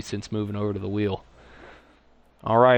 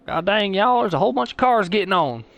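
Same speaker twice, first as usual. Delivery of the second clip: mock Texas accent; 8 semitones higher, 5 dB louder than usual. Streamer hams up a Texas accent for the viewers, higher and louder. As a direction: play up a thick Texas accent for the chat. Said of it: "this Texas accent".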